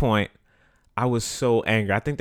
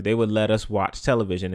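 ordinary talk, nothing marked

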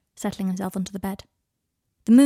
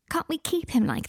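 cooing; 8 semitones higher than usual. Acted. Adelaide cooing at wolf.

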